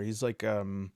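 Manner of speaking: usual speaking voice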